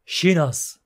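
ordinary delivery, nothing marked

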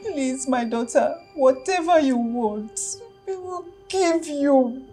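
tearful begging. Acted. Mother begging in tears.

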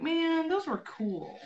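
soft, high, drawn-out lament; retold disappointment. Telling viewers about the lost glasses, disappointed. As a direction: stretch it out like a mourned loss.

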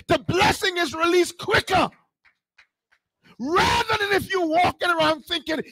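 high, urgent sermon delivery; full-force preaching. Real, not acted. Preacher warning church that blessing serves the kingdom, urgent preaching. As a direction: press the point on the congregation.

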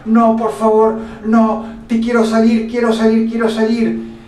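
high, loud, panicked begging to get out; terrified screaming plea. Acted. Frightened woman begs the doctor to let her out, screaming. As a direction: scream it like she's trapped and terrified. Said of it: "ella grita y grita y grita".

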